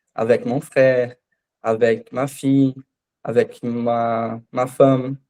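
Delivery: avec said slowly, drawn out; slow model phrases. Shown as a demonstration. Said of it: "a palavra com como avec".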